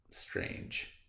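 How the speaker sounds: ordinary delivery, nothing marked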